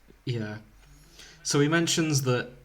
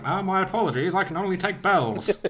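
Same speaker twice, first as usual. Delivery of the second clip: higher-pitched, apologetic shopkeeper voice; 6 semitones higher than usual. Acted. Tom Nook refuses Dracula's gold, apologetic shopkeeper voice. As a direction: politely turn down a customer in a raised voice.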